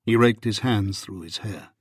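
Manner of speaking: plain reading voice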